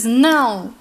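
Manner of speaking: understated outcry of refusal; mild cry. A narrator's reading. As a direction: cry out no in restrained narration. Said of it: "dá um grito e diz".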